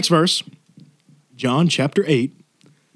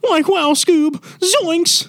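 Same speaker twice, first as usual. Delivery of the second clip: high, goofy cartoon voice, much higher; Shaggy voice; slower than usual. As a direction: yelp it like a cartoon hippie. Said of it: "He's the voice of Shaggy".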